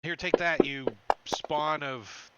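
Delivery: loud, punchy attack cry; battle taunt. Acted. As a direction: hurl it at the monster as you strike. Acting out an attack while rolling.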